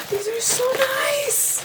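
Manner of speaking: very high, slow squealing delight; excited squeal. Real, not acted. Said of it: "I'm so excited".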